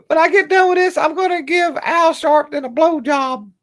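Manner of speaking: very high, sing-song falsetto; mock falsetto. Streamer mocking Maxine Waters in falsetto. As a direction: camp it up in falsetto.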